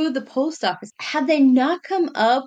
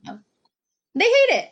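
ordinary talk

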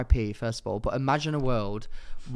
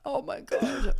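laugh-crying; slower than usual. Real, not acted. Laugh-crying over dead tortoise.

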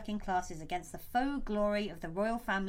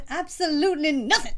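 laughing; 5 semitones higher, 5 dB louder than usual. Pretend. Answers the song quote.

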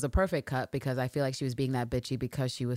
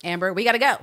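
curt call-out; 6 semitones higher, 5 dB louder than usual. Retold or imitated. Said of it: "the adult nanny shows up".

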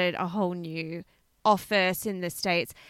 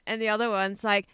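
ordinary talk, nothing marked

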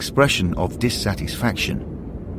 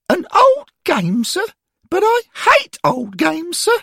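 put-on much higher, louder boy's voice, sulky about facts; a grumbling lad who hates lessons, sir; schoolboy Derek; 5 dB louder than usual. A narrator's reading. Derek complaining to the angels about facts, high and sulky.

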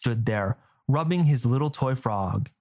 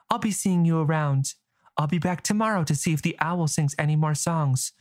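put-on light, higher young girl voice; young girl, higher and quick; girl's voice; 4 semitones higher than usual. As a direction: voice her as a curious young girl, pitched up.

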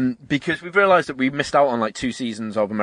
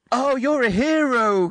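high, slow, sarcastic swoon; mock gushing; 11 semitones higher, much slower than usual. Friend scoffing at the parents' praise of the Hoff, mock gushing. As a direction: parody an adoring parent, stretched out. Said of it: "they see him start crying".

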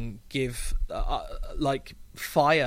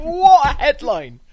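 delighted exclamation; 13 semitones higher, 13 dB louder than usual. Real, not acted. Laughing at a joke.

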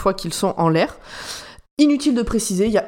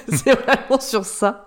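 laughing; 4 semitones higher, much slower than usual. Real, not acted. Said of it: "Je me suis dit exactement la même chose".

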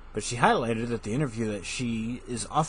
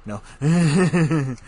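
usual speaking voice

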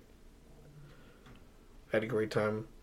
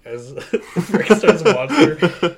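real laughter breaking through the words, louder; cracking up; 6 semitones higher, 10 dB louder, much slower than usual. Real, not acted. Co-host picturing an absurd casting, cracking up. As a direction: laugh your way loudly through it.

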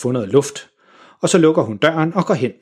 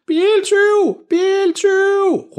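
much higher, drawn-out yell; screaming car thief; much slower than usual. A narrator's reading. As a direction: shriek the alarm. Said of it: "råber hun". (fru Jørgensen råber op om biltyv)

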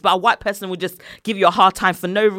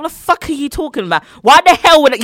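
raised, louder indignant retort; retold angry outburst; 5 semitones higher, 6 dB louder than usual. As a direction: snap back at the doctor in disbelief. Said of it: "I was getting mad".

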